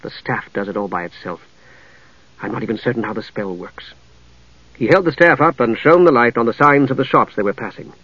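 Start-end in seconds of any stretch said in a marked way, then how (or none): 0.0-3.9 soft, low self-disparagement; quiet bitterness in a lowered voice; bitter. Acted. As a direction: disclaim any credit, bitterly. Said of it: "with a return of his bitterness".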